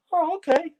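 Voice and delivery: very high, squeaky little okay; meek acceptance. Retold or imitated. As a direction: back down in a tiny high voice. Retold awed reply to blunt veteran.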